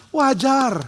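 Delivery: clapping approval. Retold or imitated. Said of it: "dia tepuk tangan sambil bilang".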